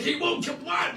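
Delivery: high-pitched imitated yell; mock shrieking. Retelling the row at home, high mock shriek. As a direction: squawk it high, as in a comic retelling. Acts out shouted family exchange.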